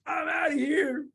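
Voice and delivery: high cartoon cry; panicked snake. Snake flees from the Gruffalo, acted panic. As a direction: squeal it and make your escape.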